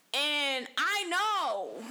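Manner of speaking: "know" stretched long and high, then falling; emphatic drawl. Real, not acted. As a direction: draw out "know" slowly for emphasis. Contrasting herself with her mother.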